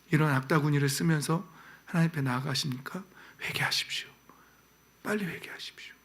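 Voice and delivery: hushed, earnest pastoral plea; quiet urgent appeal. Acted. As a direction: lean in and plead with them softly. Quiet urgent call to repent.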